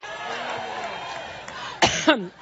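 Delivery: deep hacking cough; chesty cough. Real, not acted. Coughing fit during speech.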